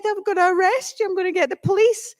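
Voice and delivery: high-pitched, quick mock threat; comically agitated, rushing at the end; angry little man. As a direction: imitate a worked-up little man. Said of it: "this really angry little man".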